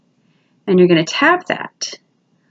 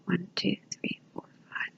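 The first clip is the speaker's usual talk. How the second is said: whispered counting; 17 dB quieter than usual. Shown as a demonstration. Teacher counting along for students, whispered. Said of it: "Count to five in your head".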